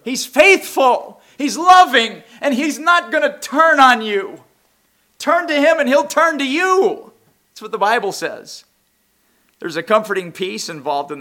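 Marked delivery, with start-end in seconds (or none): 0.0-8.6 higher and louder, fervent sermon; full-force preaching. Acted. Preacher urging the congregation, fervent and loud.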